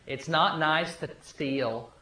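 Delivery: put-on soft and slow, a little boy gently scolding; gentle child scolding. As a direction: say it quietly and slowly, like a small child correcting adults. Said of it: "and he goes".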